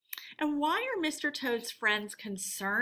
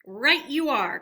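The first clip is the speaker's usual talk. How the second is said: loud eager agreement; enthusiastic cry; 3 semitones higher, 7 dB louder than usual. Acted. Rat agreeing to the rescue, enthusiastic. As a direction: jump at the plan. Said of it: "cried the rat enthusiastically".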